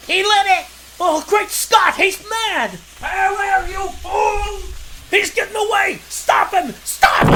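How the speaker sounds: high, loud frantic yells; panicked shouting. Acted. Dynamite lit, villain escapes.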